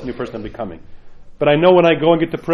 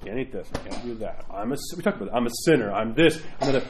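lower, softer, sulky self-pity; mock moping; 6 semitones lower, 4 dB quieter than usual. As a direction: sulk through the list of complaints. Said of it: "all I see is".

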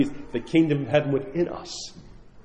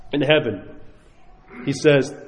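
ordinary delivery

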